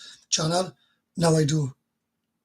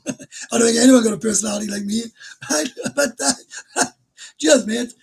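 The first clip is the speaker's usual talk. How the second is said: laughing reply; 5 semitones higher, 6 dB louder than usual. Real, not acted. Laughing at viewer's compliment.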